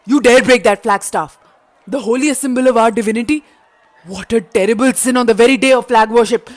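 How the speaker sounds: loud, indignant accusation; outraged rebuke. Acted. Rebel rebuking the Raja, loud and outraged. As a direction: scold him for the sacrilege.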